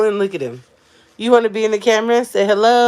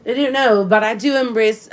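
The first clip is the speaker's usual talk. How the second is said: British accent; 2 semitones higher than usual. Shown as a demonstration. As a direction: switch into a playful British accent. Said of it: "Should I do my British accent?".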